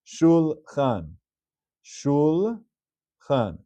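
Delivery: "shulchan" slowly, split as shul-chan; two syllables. Shown as a demonstration. Teacher reading example number two, careful two-syllable demonstration. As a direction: say "shulchan" slowly with no vowel after the l. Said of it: "Two syllables".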